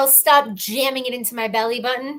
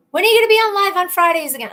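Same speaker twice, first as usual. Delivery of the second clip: high, fast mock-mom questioning; nagging mom; 8 semitones higher, 4 dB louder, faster than usual. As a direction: pester like an impatient mother. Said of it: "my mom's been like".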